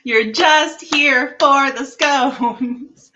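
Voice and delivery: high, loud, slow mimicry of the line; loud slow high-pitched echo; mock imitation. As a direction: belt the line back high and slow, teasing. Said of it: "That was beautiful".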